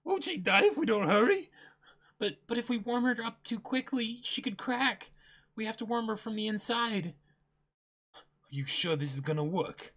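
a hint of a nervous ship's doctor; worried doctor. S0 reads manga dialogue in character.

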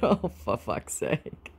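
ordinary talk

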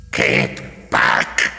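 grating mechanical warning; wary Dalek. A narrator's reading. Chained Dalek warning the approaching Doctor. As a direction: back off, machine-like. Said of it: "it said".

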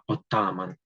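careful model pronunciation of «Отаман»; correct stress. Shown as a demonstration. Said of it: "зверніть увагу на наголос у слові". (чітко вимовити «Отаман» з правильним наголосом)

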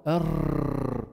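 أر with a long rolling trill; repeated ra. Shown as a demonstration. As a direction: hold أر, tongue tip vibrating many times. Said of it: "ما تعملوا، هذا غلط".

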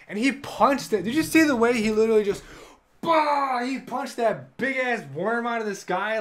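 loud, high-pitched rush of fan excitement; excited gushing. Real, not acted. Fan raving to viewers about the final fight, loud and thrilled. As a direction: shout it out like you just saw it happen.

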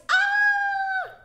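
loud, very high sustained "ah" scream; high yell. Shown as a demonstration. Teacher capping the fortissimo demonstration, yelling. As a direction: let out a long high shout.

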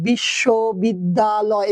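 "বিশ্ববিদ্যালয়" broken slowly into syllable chunks; split into chunks. Shown as a demonstration. Teacher asking students how to count syllables, "বিশ্ববিদ্যালয়" split slowly. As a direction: say "বিশ্ববিদ্যালয়" piece by piece, pausing between chunks. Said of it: "আমরা যদি এভাবে করবো".

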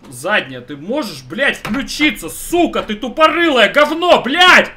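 building furious scream at the game; raging gamer. Real, not acted. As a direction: yell at the gearbox, rising to a roar. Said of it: "я начинаю бомбить".

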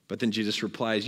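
ordinary talk

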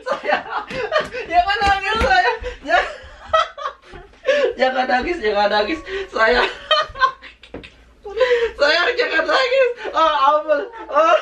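high, giggling pleas not to cry; laughing while consoling. Real, not acted. Revealing the prank to his crying wife, laughing. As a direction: laugh through the words as you comfort her.

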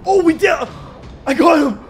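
loud high victory yell; triumphant shout. Real, not acted. Gamer cheering after finally killing the sabertooth, loud. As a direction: cheer the kill.

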